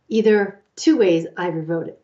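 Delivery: ordinary talk, nothing marked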